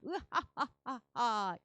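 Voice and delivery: slow, booming villain laugh. Acted. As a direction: laugh like a gloating giant. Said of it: "의기양양해서 이스라엘 사람들에게 이렇게 말해요".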